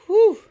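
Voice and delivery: loud, high "whew" of overheating; hot whew. Shown as a demonstration. Teacher acts out being overheated for students, loud high whew. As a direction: blow out a big hot whew. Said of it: "because if you're hot".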